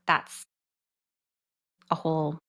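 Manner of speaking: ordinary delivery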